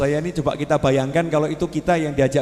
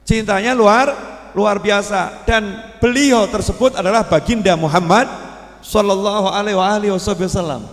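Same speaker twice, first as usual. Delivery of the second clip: raised, louder sermon voice, lifting on luar biasa; fervent preaching; 4 semitones higher, 4 dB louder than usual. Acted. Preacher to congregation proclaiming a great love, fervent. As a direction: proclaim it with fervour.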